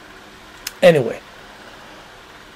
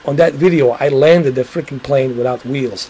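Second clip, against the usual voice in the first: ordinary talk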